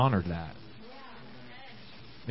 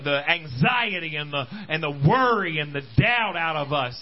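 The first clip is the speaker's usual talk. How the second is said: raised, driving preacher's voice; fervent preaching; 4 semitones higher than usual. Acted. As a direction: stir the church up with rising fervour. Preaching at full force.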